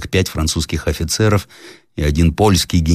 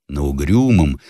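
ordinary delivery

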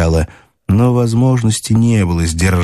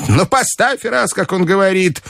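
commanding grandmother; 11 semitones higher than usual. A narrator's reading. Grandmother ordering the narrator to bet, high and commanding. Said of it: "приказала мне бабушка".

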